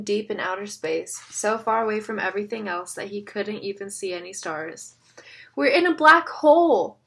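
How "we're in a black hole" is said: loud high panicked scream. A narrator's reading. Kid screaming in the dark cave, panicked yell. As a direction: scream it in terror. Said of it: "someone screamed behind him".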